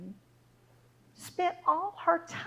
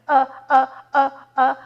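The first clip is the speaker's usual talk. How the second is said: loud, separate stunned uhs; panicked stammer; 11 dB louder than usual. Acted. Daughter speechless before the queen, loud alarmed stammering. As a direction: stammer loudly, lost for words.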